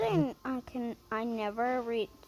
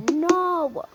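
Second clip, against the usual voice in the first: higher mock-yelled refusal; retold yell; 7 semitones higher, 4 dB louder than usual. Others refusing the parent's claim, mock yell. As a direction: imitate someone yelling back, pitched up. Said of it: "yell at me".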